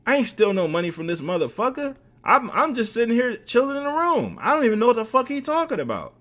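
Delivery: very high-pitched protesting; hammed-up defensive outburst; indignant denial. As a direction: imitate an accused man pleading innocence. Accused man's indignant denial.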